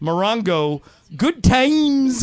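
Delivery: "good times" high and loud; hyped casino promo, punched ending; promo read. Shown as a demonstration. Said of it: "I will do the Morongo read".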